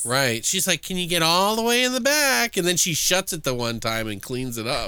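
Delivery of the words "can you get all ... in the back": high, sugary grandma voice; sweet old lady voice. Retold or imitated. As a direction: coax sweetly as a creepy grandma. Quoting the grandmother.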